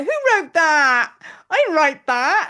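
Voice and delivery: mock astonishment. Host acts out rereading her channeled writing, astonished. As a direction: act out being stunned by your own writing. Acting out surprise reading back.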